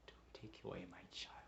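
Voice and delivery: husband whispering a threat to his wife; whispered. Acted. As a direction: drop to a menacing whisper. Sudden drop mid-rant.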